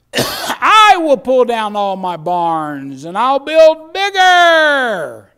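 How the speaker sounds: loud, high, long-drawn final words; booming, impassioned Bible recitation; full-force scripture preaching. Acted. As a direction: proclaim the verse to the whole church.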